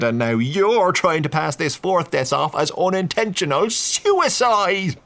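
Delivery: heated Duke rant; angry accusation. A narrator's reading. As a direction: Duke attacking the priest.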